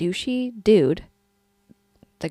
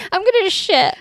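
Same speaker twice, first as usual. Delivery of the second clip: high mock excuse; 10 semitones higher, 6 dB louder than usual. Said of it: "You know what?".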